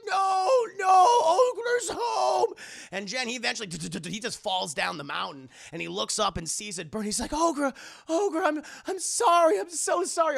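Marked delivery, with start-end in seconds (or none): 0.0-2.5 very high, loud, slow crying shout; Ogra's wailing cry. Retold or imitated. Ogra cries out as her observatory burns, very high loud wailing shout. Ogra watches home burn. 7.3-10.4 very high, loud pleading cry; Jen's anguished apology. Retold or imitated. Jen shouts his apology to Ogra as he flees, very high loud anguished cry.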